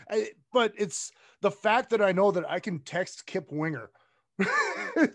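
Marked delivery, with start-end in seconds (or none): 4.4-4.9 high giddy laugh. Real, not acted.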